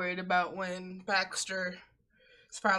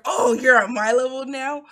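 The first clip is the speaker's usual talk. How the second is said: loud, raised voice as a thrilled dog; excited dog; 4 semitones higher, 10 dB louder than usual. Retold or imitated. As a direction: imitate a dog delighted by sudden opportunity. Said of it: "Baxter was like".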